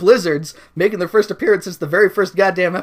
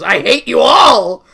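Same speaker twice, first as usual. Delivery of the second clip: shouted tantrum; 8 semitones higher, 11 dB louder, slower than usual. Acted. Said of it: "and she goes".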